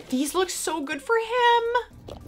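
high, slow, drawn-out gush; gushing delight. Real, not acted. Vlogger thrilled by dog treats, high gushing. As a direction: coo with delight, stretching the words.

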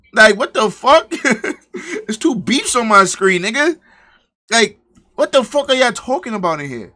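loud, high-pitched disbelief with a laugh breaking in; baffled outburst. Real, not acted. Streamer to his chat, baffled at what they're talking about, loud outburst.

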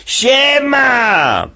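high, cocky yell; shouted greeting. Acted. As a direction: burst in hollering hello. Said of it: "i krzyczy".

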